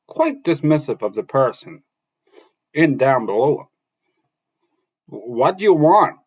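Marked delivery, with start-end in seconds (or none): 5.0-6.2 louder, slower call down; exclaimed. Acted. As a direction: call down slowly and loudly. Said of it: "Rand exclaimed".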